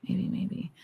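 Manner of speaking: whispered under the breath; whispering. Real, not acted. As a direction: say it in a whisper to yourself. Muses about the gun name.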